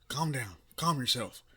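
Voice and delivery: forceful, loud call to calm; loud calming order. Acted. Mentor ordering the flustered boy, loud and firm. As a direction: order him to calm himself.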